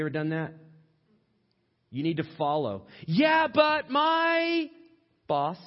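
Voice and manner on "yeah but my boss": very high, loud, drawn-out protest; exaggerated whiny excuse. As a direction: wail the objection, stretching every word. Acted excuse, exaggerated repeat.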